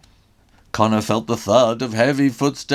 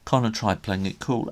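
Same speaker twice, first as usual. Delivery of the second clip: usual speaking voice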